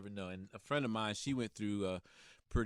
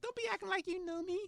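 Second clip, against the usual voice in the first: imitating a Spanish accent, high and sassy; playful Spanish-accented snap-back. Imitating Serena brushing him off, Spanish accent. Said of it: "She's Spanish. She's got that little accent".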